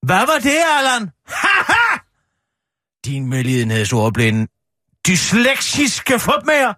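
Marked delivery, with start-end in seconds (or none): 1.3-2.0 shrill triumphant haha; triumphant laugh. Real, not acted. Catches Allan out triumphantly. 3.1-6.7 mock insult. Mock scolding after catching him.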